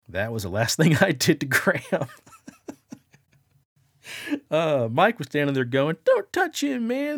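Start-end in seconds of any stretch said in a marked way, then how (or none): 6.0-7.2 high, loud retold protest; alarmed protest. Friend warns him off the body in the casket, alarmed. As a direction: imitate a friend urgently objecting. Friend objecting as corpse is prodded.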